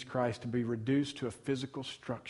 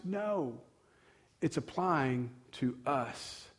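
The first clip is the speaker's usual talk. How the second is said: soft, slow correction on us; quiet slow correction, weight on us; 7 dB quieter, much slower than usual. Acted. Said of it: "We are the body".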